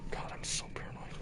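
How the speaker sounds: whispered aside; whispering. Real, not acted. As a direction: breathe it under your breath. Muttered aside after loud lines.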